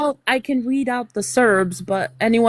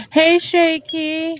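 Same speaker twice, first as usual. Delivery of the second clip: drawn-out, higher-pitched call; 6 semitones higher, much slower than usual. Real, not acted. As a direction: call out slowly to get their attention. Calling out to someone absent.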